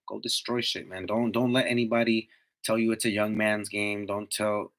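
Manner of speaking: normal talking voice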